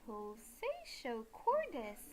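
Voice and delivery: slow, swooping stretch on each word; exaggerated, elongated game title; drawn-out title call. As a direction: draw PULSATIO CORDIS out playfully. Playful calling of the loading game's title.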